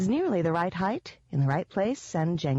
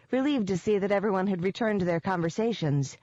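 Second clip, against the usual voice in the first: usual speaking voice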